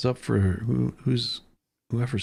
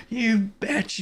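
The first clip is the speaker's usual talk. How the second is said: retold yell; 10 semitones higher than usual. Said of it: "yelling at the top of his lungs".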